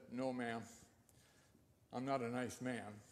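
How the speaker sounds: put-on low, quiet, plain-spoken old biker; lightly voiced gruff man. Gruff ex-convict voiced in story.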